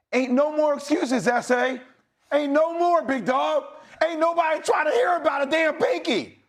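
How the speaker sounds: high, urgent pep talk; impassioned locker-room rallying cry; fired-up rallying speech. Real, not acted.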